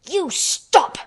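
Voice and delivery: high-pitched furious command; near shout. A narrator's reading. Furious girl storms at the screaming boy, almost shouting. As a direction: order it with the pitch jumping up. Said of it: "she almost shouted".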